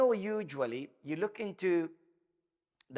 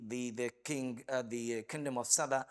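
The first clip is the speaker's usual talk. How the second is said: ordinary delivery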